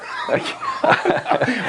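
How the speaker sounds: high-pitched burst of laughter; laughing. Real, not acted. Host joking with guest about college courses, laughing.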